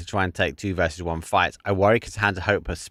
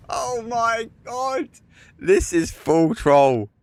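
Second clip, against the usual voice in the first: very high, slow, strained through laughter; higher, drawn-out giggly disbelief; laughing disbelief; 13 semitones higher, 3 dB louder, much slower than usual. Real, not acted. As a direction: squeeze it out between laughs.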